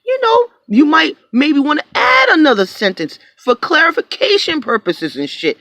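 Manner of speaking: sarcastic loud advice; venting about unclear buyers; high loud rant. Real, not acted. Continuing the outburst.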